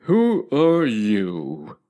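stretched, slow caterpillar question; very slow drawl. A narrator's reading. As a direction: draw every word out lazily. Caterpillar repeats his question.

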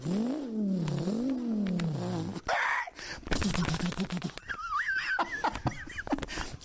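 long revving whine breaking into cackles; drawn-out vocal engine sounds and mock laughter; imitated engine noise. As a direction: rev up like a supercharged car, then laugh. Imitates supercharged car, then laughs.

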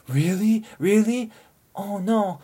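high, loud alarmed mom voice; sudden alarmed worry from the mom; worried mom. Retold or imitated. Korean mom hearing her daughter is drunk, alarmed. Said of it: "she gets really worried all of a sudden".

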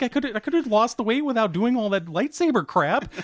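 high-pitched mock grumbling; retold exasperated gripe; Mark Hamill. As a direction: imitate an actor whining about the workout. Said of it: "Quit your bitching".

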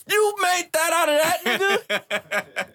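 high, slow, laughing disbelief; stunned disbelief. Retold or imitated. Retelling his reaction to the sample, high and laughing. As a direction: act floored by what you just heard. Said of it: "I'm like".

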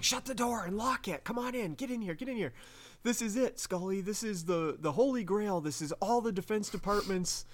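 high-pitched, hurried mock urgency; excited imitation of a man with a secret; urgent retelling. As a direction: act out frantically ushering someone inside. Mulder urgently ushering Scully in.

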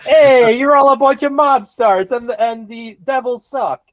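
high, loud jeering at the fans; hammed-up sports-fan taunt; mock heckling. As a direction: heckle them like a rowdy rival fan. Mock jeer at New Jersey fans.

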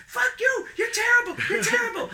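very high, loud mock shrieking; imitated screaming heckler. Imitating the old man screaming at the band, shrieking. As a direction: shriek the insults like an irate heckler. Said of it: "leaves just screaming about how awful we were".

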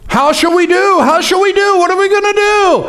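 high, anxious servant's cry; frightened servant. Acted. Servant panicking to his master about the army, high and anxious.

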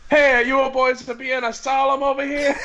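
high, hammed-up Italian mobster; mock Italian accent. Another Tony joining the bit, mock Italian accent. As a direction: butt in with a cartoon Italian accent.

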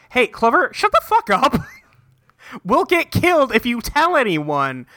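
sudden high shouting hush-up; mock yell. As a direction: yell it at her to shut up. Friends silence Clover, retold.

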